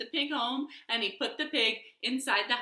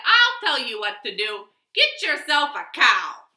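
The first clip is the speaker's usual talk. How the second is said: put-on louder, confident old adviser; hearty wise man; 10 dB louder than usual. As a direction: give the advice proudly. Wise man's advice.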